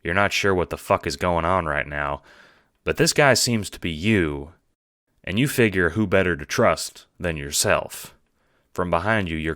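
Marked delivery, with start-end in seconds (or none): none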